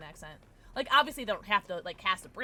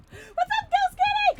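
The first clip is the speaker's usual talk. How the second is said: much higher, louder cutesy hello; squeaky greeting; 10 dB louder, slower than usual. Real, not acted. Streamer greeting the killer Ghost Kitty, high squeak. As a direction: chirp the greeting way up.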